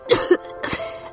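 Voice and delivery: weak cough. Acted. Feverish girl coughs mid-question.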